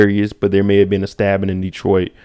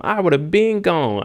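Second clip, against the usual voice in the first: ordinary delivery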